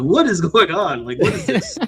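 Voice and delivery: amused disbelief. Retold or imitated. Teen seeing racy TV.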